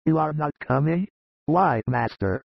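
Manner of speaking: understated astonishment; mildly surprised. A narrator's reading. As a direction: question ending on a rise. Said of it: "who is greatly surprised".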